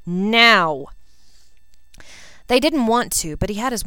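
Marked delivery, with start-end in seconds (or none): 0.0-0.9 loud, sharp order; snapped command. A narrator's reading. Draco ordering the boys out, loud sharp command. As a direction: bark it at the boys. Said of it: "he snapped".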